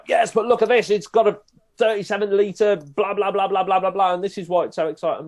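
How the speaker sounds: high, loud, rushing showman patter; excited commentator. Shown as a demonstration. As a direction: hype the crowd up about the car. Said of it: "and make it exciting".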